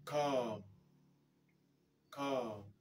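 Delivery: "Calm" said with a long ah and a silent l; English calm. Shown as a demonstration. A teacher models "Calm" for learners, a long ah with a silent l. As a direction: model "calm" clearly as kahm. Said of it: "comme dans".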